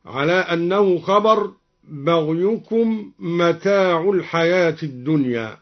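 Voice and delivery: ordinary talk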